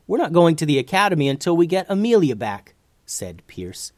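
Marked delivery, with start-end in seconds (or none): 0.1-2.7 high, loud, fast protest; urgent young hero digging in; determined refusal. A narrator's reading. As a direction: insist firmly and quickly. Hero refuses to abandon friend.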